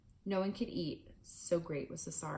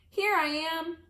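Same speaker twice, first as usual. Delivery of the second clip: loud high answering call; tiny mouse calls back to his family; 11 semitones higher, 9 dB louder, slower than usual. Acted. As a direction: call out to be found. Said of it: "called back".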